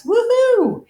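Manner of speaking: high, loud whoop of triumph; gleeful victory whoop; cheering woohoo. Acted. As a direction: cheer like you just won. Balls divided, team cheers.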